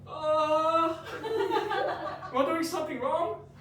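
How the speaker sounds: laughing. Real, not acted. Role-playing a rejection with friends, laughing it off. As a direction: question breaking into a high laugh.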